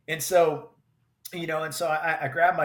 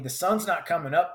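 ordinary talk

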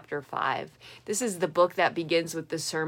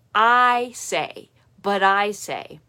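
I in "I say" pressed hard and louder; the louder "I" of "I say, but I say" carries authority; emphatic I say; 5 dB louder, slower than usual. Retold or imitated. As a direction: lean into "I say" as a firm command. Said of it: "the emphatic personal pronoun".

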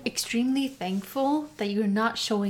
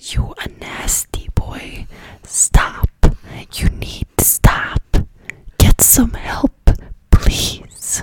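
loud, forceful whisper; scolding stage whisper; 8 dB louder, slower than usual. Real, not acted. Listener telling the nasty boy to stop, fierce whisper. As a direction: whisper hard and slow, scolding him.

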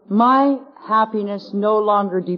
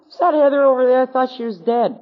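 higher-pitched, louder voice of a startled friend; mild retold surprise; 6 semitones higher, 4 dB louder than usual. Friend spots her at the penitentiary after months away, mildly surprised.

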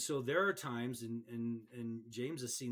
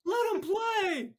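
coach yelling; 10 dB louder than usual. Retold or imitated. Coach on the sideline yells at the referee, loud and high. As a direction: bellow across the field at the ref. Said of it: "his coach yells out".

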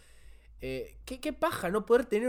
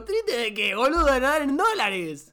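higher, drawn-out teasing retort; mocking retort; 6 semitones higher, 4 dB louder, slower than usual. Joking back at Román's sad confession, mocking. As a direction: tease a friend, stretching out the ending. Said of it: "y yo digo".

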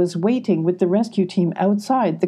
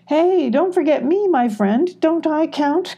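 complaining; 10 semitones higher than usual. A narrator's reading. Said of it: "complained Moon".

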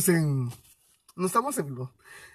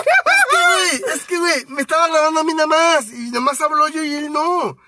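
whiny complaint; 7 semitones higher, 4 dB louder than usual. Real, not acted. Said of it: "uno se siente acosado".

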